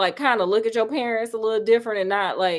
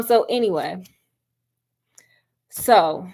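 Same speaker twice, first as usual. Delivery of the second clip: normal talking voice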